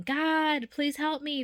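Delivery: high, desperate plea for help; pleading cry to God. Retold or imitated. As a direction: beg God from behind the wall, high and urgent.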